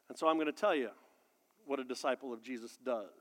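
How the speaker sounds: soft, low teasing hush; hushed tease. Acted. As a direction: draw them in quietly.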